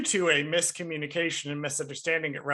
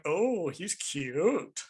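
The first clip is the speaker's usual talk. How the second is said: mock old barfly; much slower than usual. Two older daddies at the bar.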